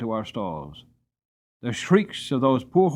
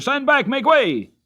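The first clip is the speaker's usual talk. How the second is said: much higher and louder barked orders; shouted command; 12 semitones higher, 7 dB louder than usual. A narrator's reading. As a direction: clear the crowd urgently. Firemen clearing the yard.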